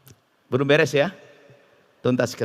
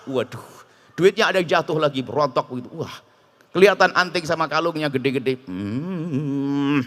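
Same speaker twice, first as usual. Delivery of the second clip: normal talking voice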